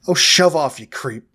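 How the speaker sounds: put-on loud, brash Irish accent. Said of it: "with a thick Irish accent".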